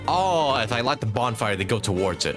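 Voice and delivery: ordinary talk, nothing marked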